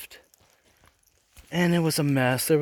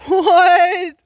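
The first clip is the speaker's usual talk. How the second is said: high, loud disbelieving yelp; incredulous what; 10 dB louder than usual. Retold or imitated. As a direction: blurt it out in total disbelief. Baffled reply to principal.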